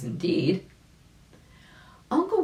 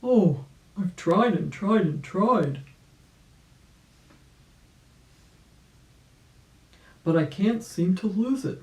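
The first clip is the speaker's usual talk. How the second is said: put-on weary old rabbit; 4 semitones higher, 4 dB louder than usual. Old rabbit to the circus dog, a weary complaint. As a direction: sigh it out like a tired old rabbit. Uncle Wiggily laments rheumatism.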